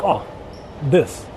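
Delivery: normal talking voice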